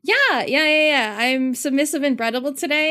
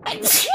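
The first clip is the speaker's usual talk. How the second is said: sneeze; 8 semitones higher than usual. Real, not acted. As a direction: let out a sharp sneeze. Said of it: "I'm gonna sneeze".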